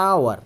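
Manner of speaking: আওয়ার opening straight on the vowel; teacher models আওয়ার; silent h. Shown as a demonstration. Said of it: "এই যে আওয়ার".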